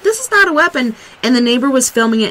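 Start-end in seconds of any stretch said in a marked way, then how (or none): 0.0-0.9 higher-pitched imitated protest; retold protest. Man telling armed cops about his trash tool, retold protest. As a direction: quote his protest, pitched up with a fall on "not". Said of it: "He's like".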